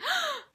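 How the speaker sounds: sharp, very high gasp of shock; replayed squeal-gasp in the carpool; excited gasp. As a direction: gasp like you just got noticed by your idol. Reacting to celebrity tweet back.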